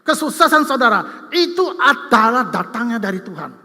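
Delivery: a pastor pressing humility on the church, in forceful preaching; full-force preaching. Acted.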